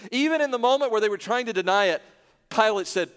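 impassioned pulpit delivery; full-force preaching. Real, not acted. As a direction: preach it out with mounting indignation.

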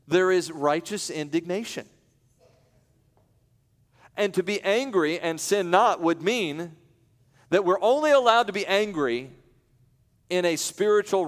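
preaching. Acted. Preacher building on righteous anger.

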